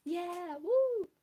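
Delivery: high, loud whoop; excited woo. Real, not acted. Streamer whooping at the Yume Nikki soundtrack, joyful. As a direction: let out a happy whoop. Cheering at the music.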